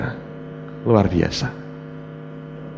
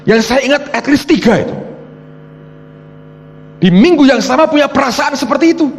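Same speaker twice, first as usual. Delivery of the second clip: high-pitched, driving testimony; forceful preaching; 5 dB louder than usual. Real, not acted. Preacher telling congregation others shared the premonition, forceful high preaching. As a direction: hammer out the amazing fact in a raised pulpit voice.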